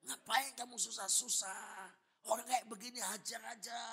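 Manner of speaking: high, loud, drawn-out egging-on voice; slow goading shout, acted; tempting devil. Devil urges the pastor to hit back, loud drawn-out goading. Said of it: "Setan bisik".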